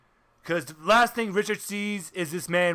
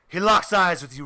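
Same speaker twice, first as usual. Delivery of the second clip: ordinary talk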